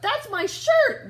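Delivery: high, loud, slow shocked gasp. A narrator's reading. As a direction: cry it out in disbelief. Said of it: "gasped Jerome".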